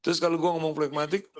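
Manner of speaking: ordinary talk, nothing marked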